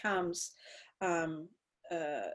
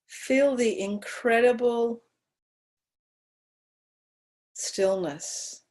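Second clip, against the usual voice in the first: guided meditation; 6 dB louder than usual. Acted.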